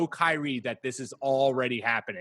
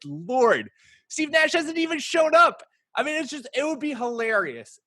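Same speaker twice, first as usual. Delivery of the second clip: excited; 11 semitones higher, 4 dB louder than usual. Real, not acted. Excited rant about Kyrie chaos.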